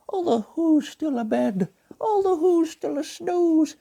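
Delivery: gentle cartoon bear narrating; Pooh Bear voice. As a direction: read it as a gentle honey-loving bear. Pooh Bear reads the story.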